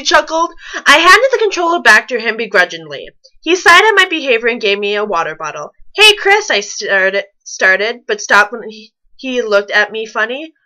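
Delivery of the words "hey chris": calling out. Acted. Calling out to him.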